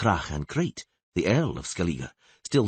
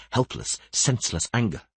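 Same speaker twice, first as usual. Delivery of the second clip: plain reading voice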